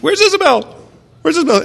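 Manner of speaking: loud, very high mock-child yell; panicked little boy. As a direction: mimic a frantic kid calling out. Imitates son calling out anxiously.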